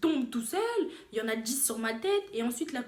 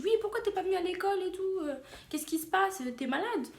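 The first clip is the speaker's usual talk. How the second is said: higher, quick questioning voice; friend on the phone; 8 semitones higher than usual. Retold or imitated. Friend phoning about missed school, imitated questioning. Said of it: "ma copine qui m'aidait".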